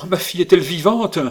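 loud, high, rushed plea; urgent mother's cry; explosive outburst. A narrator's reading. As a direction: demand it desperately, voice rising. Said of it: "fit la duchesse avec explosion".

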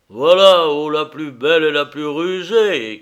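very loud, exclaimed cry; shouting. A narrator's reading. Cyclope to the cunning ship he admires, shouting. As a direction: burst out loudly, then sustain the call. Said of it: "cria le cyclope".

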